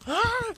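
loud, very high-pitched shriek; high scream. Pretend. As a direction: shriek as if grabbed.

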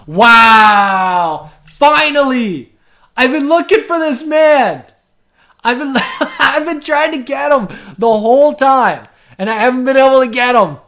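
loud, high, thrilled outburst; excited shout. Real, not acted. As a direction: burst out with delight at finally getting him. Finally lands wanted player.

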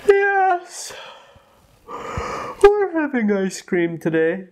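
high sleepy yawning voice. A narrator's reading. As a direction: yawn the words out slowly. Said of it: "yawned Mister Goose".

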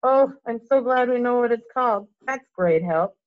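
angry mock thanks; snarling sarcasm. A narrator's reading. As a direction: bite back with bitter sarcasm. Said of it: "snarled Ron".

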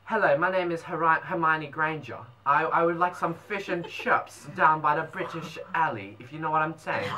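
put-on English schoolgirl accent; mock-proper British accent. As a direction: attempt British English as Hermione. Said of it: "British, okay".

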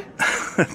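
kurzes, gehauchtes nervöses Auflachen; verlegenes Lachen. Acted. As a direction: ertappt auflachen. (Mann lacht ertappt und verlegen, gehaucht)

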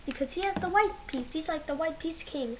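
ordinary talk, nothing marked

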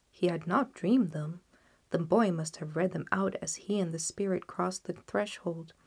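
usual speaking voice

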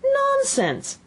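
very high, decisive exclamation; ringing, no-nonsense outburst; loud and decided. A narrator's reading. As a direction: cut in firmly at a high pitch. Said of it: "very loudly and decidedly".

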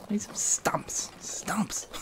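softly whispered search; hushed whisper ending in a breathy laugh. Acted. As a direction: whisper it to yourself.

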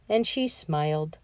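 ordinary delivery, nothing marked

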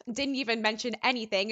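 usual speaking voice

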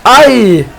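surprised cry. Real, not acted. Sudden ball drop.

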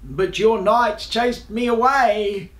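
indignant beast, building to a high finish; roaring dragon. A narrator's reading. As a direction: plead the case loudly as an aggrieved dragon. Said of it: "he roared".

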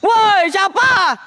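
high, drawn-out call into the dark; startled call out. Real, not acted. Hunter calls into the dark to an unseen presence, startled and high. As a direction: call out slowly, high and alarmed.

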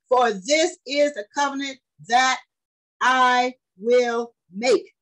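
slow, weighty, drawn-out reading; preaching scripture. Acted. Preacher reading the covenant to his congregation, slow and weighty. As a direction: lay each word down slowly.

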